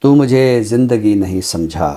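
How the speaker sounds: ordinary talk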